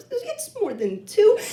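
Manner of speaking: high, slow, rising to a question; nervous admission. Retold or imitated. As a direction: squeak out a reluctant confession. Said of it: "You look a little nervous".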